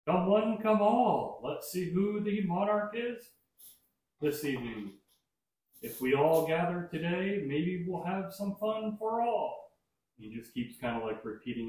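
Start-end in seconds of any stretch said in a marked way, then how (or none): 0.1-9.6 high, loud booming carnival call; giant alien booming voice. Acted. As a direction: boom it out to the whole carnival. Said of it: "you hear a booming voice echo across the entire carnival".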